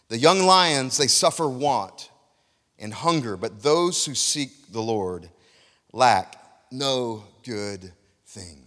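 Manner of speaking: slow right down and drop low on the closing words; scripture reading. Acted. Preacher reading a psalm verse to the congregation, slow and solemn.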